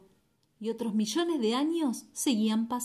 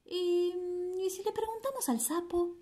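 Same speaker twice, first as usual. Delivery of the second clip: high character voice; 8 semitones higher, 4 dB quieter than usual. Acted. Yacaré's question, character voice.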